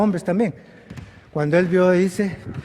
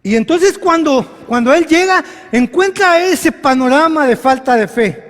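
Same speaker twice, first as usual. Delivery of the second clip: higher and louder, driving the point home; full-force preaching; 9 semitones higher, 8 dB louder than usual. Acted. Preacher building to the crowd, full force. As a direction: preach it out over the congregation.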